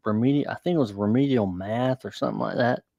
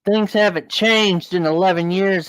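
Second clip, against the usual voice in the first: mocking, pitched higher and louder; smug taunt; 6 semitones higher, 3 dB louder than usual. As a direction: gloat at an old student. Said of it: "he goes".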